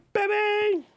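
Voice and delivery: high, delighted whoop; excited cry. Real, not acted. Showing off his favourite camera with a high excited whoop. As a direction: greet the camera like a long-lost love.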